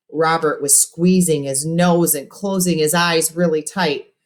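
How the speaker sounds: plain reading voice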